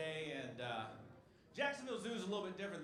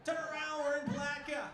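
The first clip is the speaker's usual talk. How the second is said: higher and louder, calling it out; loud announcement; 10 semitones higher, 6 dB louder than usual. Acted. Comic calls out the town to the crowd, loud. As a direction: call out the bad news to the car. Joke announcing Palatka arrival.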